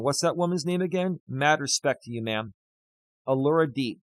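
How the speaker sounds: ordinary delivery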